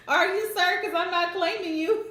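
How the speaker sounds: high, loud sassy tease; laughing sassy comeback; teasing sass. Real, not acted. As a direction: tease him through laughter. Teasing the reader's message.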